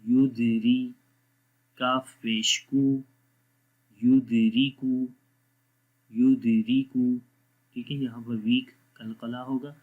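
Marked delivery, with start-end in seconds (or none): none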